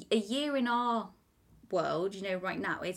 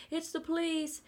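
raised pitch, officer at the door; mock police call; 9 semitones higher than usual. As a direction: call out like a cop knocking. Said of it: "the police knock on the door being like".

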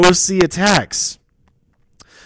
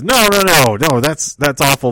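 mock loud refusal; 5 dB louder than usual. Champion rejects the four-way idea, mock loud protest. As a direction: play the champ flatly refusing, raised voice. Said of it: "And A J says".

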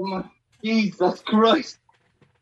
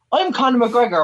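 mimicked accent, higher and louder; Conor McGregor; 5 semitones higher, 3 dB louder than usual. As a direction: put on the caller's accent, pitched up. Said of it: "why am I even doing this accent".

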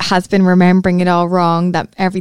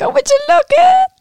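very high-pitched squeal of recognition; playful high squeak; delighted mock exclamation. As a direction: act out your thrilled reaction, way up high. Acting out delighted reaction to scene.

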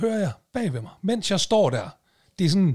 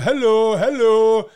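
loud, high, drawn-out "hallo"; imitated calling out; 5 semitones higher, 7 dB louder, much slower than usual. Stranger calling while the man digs for a card, imitated holler. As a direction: mimic a nagging call for attention. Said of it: "Mens han ligesom står og siger".

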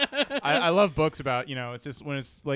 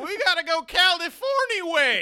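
high, loud laughing prospector; old prospector; 7 dB louder than usual. Pretend. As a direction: crow like a gold-rush geezer. Prospector bit continues.

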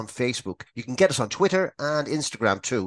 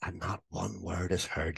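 ordinary talk

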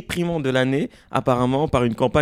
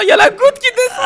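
squealing with cold; 5 dB louder than usual. Real, not acted. Reacting to cold drop.

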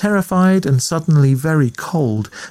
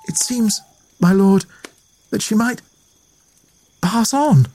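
frightened; 9 semitones higher than usual. A narrator's reading. Frightened maid speaks.

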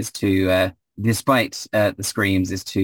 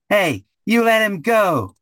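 high snap; 12 semitones higher, slower than usual. Acted. GM voices Ezzy snapping out.

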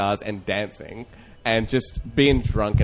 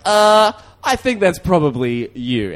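retold comeback; 9 semitones higher, 9 dB louder, slower than usual. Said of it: "And I was like".

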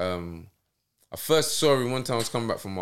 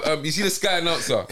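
ordinary delivery

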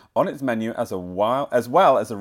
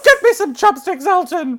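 demanding diva; 7 dB louder than usual. Pretend. Demanding star ordering [name] around.

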